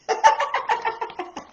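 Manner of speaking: high breathy laugh; grandma's laughter. Acted. Storyteller laughs for Grandma at the end. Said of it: "Grandma just laughed and laughed".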